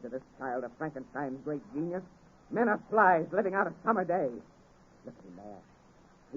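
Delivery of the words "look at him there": very soft and slow, almost breathed; awed hush. Acted. Victor to Waldman over the giant, hushed awe. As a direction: marvel at him under your breath.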